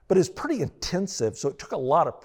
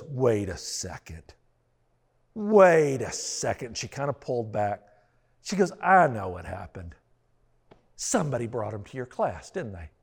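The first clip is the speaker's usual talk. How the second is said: suspicious realization; 6 semitones lower than usual. Retold or imitated. Wife realizes the truth.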